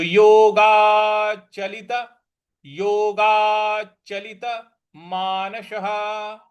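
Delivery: योगाचलित मानसः slow, with मानसः ending in a breathy ह; slow careful recitation. Shown as a demonstration. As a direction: model योगाचलित मानसः slowly. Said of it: "इस प्रकार से बोलेंगे".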